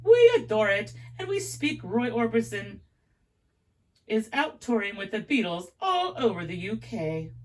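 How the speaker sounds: put-on English promoter gushing in a British accent. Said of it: "my accent's horrible".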